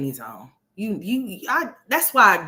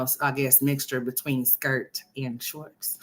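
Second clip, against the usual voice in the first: normal talking voice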